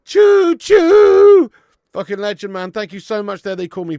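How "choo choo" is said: very high, full-volume choo choo; shouted train whistle. Pretend. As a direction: blast it like a real locomotive. Repeated train call, louder.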